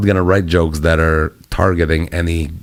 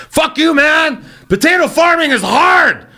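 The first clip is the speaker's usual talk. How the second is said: a much higher, yelling mock tirade from the angry farmer's son; furious shout; slower than usual. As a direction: scream it like the son storming out in a rage. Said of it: "smashes his beer on the floor".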